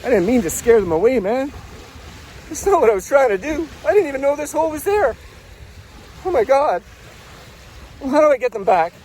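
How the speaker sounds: high, anguished protest; desperate, guilt-stricken outburst; distraught. Acted. Realises he drove family away.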